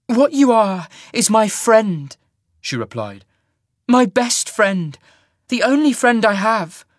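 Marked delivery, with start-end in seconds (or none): 0.1-2.2 high, loud, slow heartfelt appeal; emotional appeal. A narrator's reading. Sasha to her friend who embraces the monster, pleading. Friend begging him not to change. 3.9-6.9 high, loud, drawn-out plea; emotional appeal. A narrator's reading. Sasha to the only friend she has, pleading. Friend begging him not to change.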